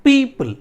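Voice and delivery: People with a loud, high पी; stress on first syllable. Shown as a demonstration. Teacher showing which syllable carries stress, first one punched. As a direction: punch the पी of people, let पल fall. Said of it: "तो पी पे पड़ रहा है".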